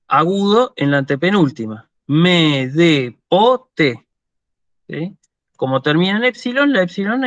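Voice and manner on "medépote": "Medépote" stretched very slow, each syllable held; drawn out slowly. Shown as a demonstration. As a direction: linger over "Medépote" so students catch the stress. Teacher repeats word slowly. ("Medépote" muy lento y alargado)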